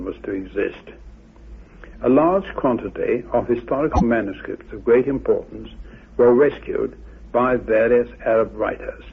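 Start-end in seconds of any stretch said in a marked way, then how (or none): none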